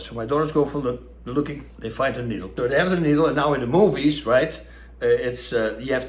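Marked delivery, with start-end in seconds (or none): none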